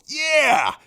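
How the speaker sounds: a smug, knowing little yeah; sly gloating yeah; smirking yeah. Retold or imitated. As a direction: say it with a satisfied smirk. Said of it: "I just smirk and I go".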